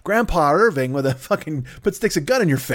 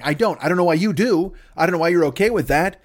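usual speaking voice

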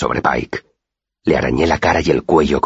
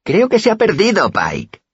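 jeering thug. A narrator's reading. The boy on the right mocking the lost kid to Pike, high jeering. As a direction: jeer at the captive for the gang leader. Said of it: "dijo el que estaba a mi derecha".

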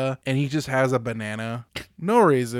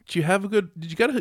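ordinary talk

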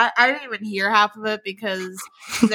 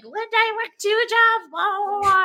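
mock ranting; 7 semitones higher than usual. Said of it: "all of a sudden you're like".

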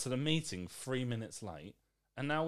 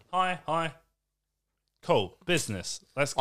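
brisk business talk; 3 semitones higher, 6 dB louder than usual. Retold or imitated. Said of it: "Why not just get to business?".

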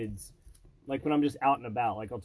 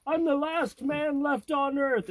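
much higher, slow, theatrical doom; mock dramatic; 13 semitones higher, 3 dB louder, slower than usual. Retelling a Twilight Zone plot, in a hammed-up dramatic voice. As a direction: ham up the lone survivor's lament.

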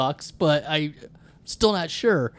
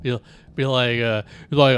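ordinary delivery

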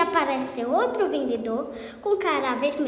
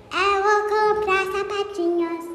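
tipsy voice; 6 semitones higher, slower than usual. Acted. Patão drunk on wine.

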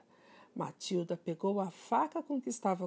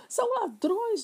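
usual speaking voice

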